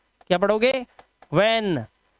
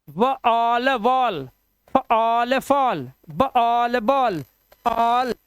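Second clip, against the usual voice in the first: 1 slow blending; much slower than usual. Shown as a demonstration. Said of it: "teacher blending sounds into words".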